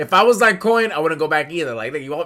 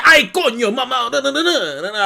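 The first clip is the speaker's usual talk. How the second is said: loud, higher mock dub Frieza; Frieza Spanish dub; 5 semitones higher, 4 dB louder than usual. Friend voicing Frieza in the Spanish dub, hammed-up. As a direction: blurt it like an overacted villain dub. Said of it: "Frieza be like".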